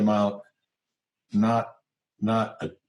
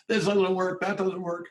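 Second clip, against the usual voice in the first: much higher, exasperated complaint voice; mock complaining callers; 10 semitones higher than usual. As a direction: imitate flustered people calling in with problems. People calling with complaints.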